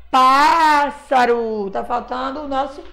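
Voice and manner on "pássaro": Pássaro slow, with a long open PÁ; wide-open A. Shown as a demonstration. Teacher hinting at the acute accent, long open PÁ in Pássaro. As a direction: open the mouth wide and hold the first A of "Pássaro". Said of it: "eu abri bem muito a minha boca".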